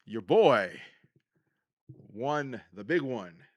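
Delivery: ordinary delivery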